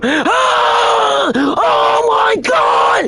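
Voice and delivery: high, drawn-out mock scream of terror; imitated screams. Voicing screams from the boss room, shrieking. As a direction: shriek like a player getting wrecked. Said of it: "This is all you hear".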